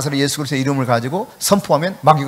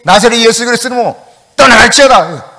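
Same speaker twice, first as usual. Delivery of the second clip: loud, fast, higher solemn command; retold joint command; 6 semitones higher, 9 dB louder than usual. Two pastors commanding the demon together, loud retold proclamation. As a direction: declare it with full force.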